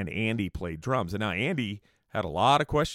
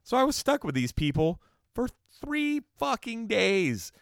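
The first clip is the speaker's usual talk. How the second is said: high, exasperated grievance; real annoyance climbing to a high-pitched peak; still pissed; 6 semitones higher than usual. Real, not acted. Host still sore at the houseguest who shaved him, pissed off. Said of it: "I'm still pissed about it".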